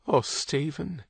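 a woman on the edge of sobbing, lightly acted; near tears. A narrator's reading. Kate about to dissolve into sobs.

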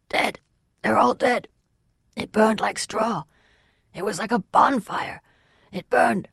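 high, strained hoarse voice; hoarse, distraught fugitive. A narrator's reading. Fugitive telling the Waylord about the burning tent, hoarse distress. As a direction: gasp out the horror in short, high phrases.

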